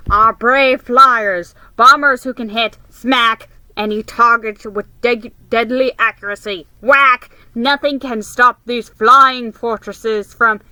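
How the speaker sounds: boy imitating a radio announcer; mock radio announcer. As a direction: boom it like a wartime broadcaster, mockingly. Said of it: "imitated the deep voice of radio announcers".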